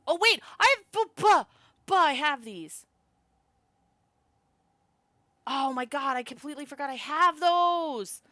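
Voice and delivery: loud, high burst of discovery; excited realization. Real, not acted. Player spots forgotten cards, loud excited realization. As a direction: blurt it out, stammering with sudden relief.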